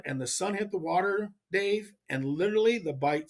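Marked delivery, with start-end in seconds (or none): none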